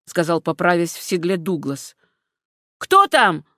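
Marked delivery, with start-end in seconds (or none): 2.8-3.4 higher, louder sharp call into the dark; shouting. A narrator's reading. Gregor calls out at the rustling brush, shouting. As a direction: bark out a challenge. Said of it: "закричал Грегор".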